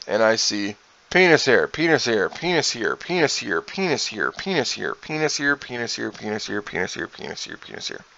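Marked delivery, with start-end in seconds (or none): 1.1-8.0 fading repetitive listing. Retold or imitated. Counting the marker doodles seen in the mirror, repetitive fading murmur. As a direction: chant it over and over, trailing away.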